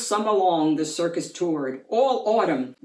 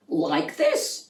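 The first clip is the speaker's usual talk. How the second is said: cheery acrobat; 9 semitones higher than usual. A narrator's reading. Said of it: "cried Annie the acrobat".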